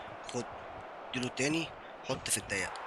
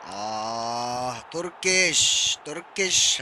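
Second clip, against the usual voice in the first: drawn-out call; 4 semitones lower, much slower than usual. Real, not acted. Drawn-out mock lament at opponent.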